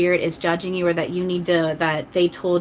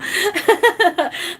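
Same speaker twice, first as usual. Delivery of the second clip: high burst of delighted laughter; 13 semitones higher, 4 dB louder than usual. Real, not acted. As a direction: laugh out loud at a fun question. Reacting to Sims question.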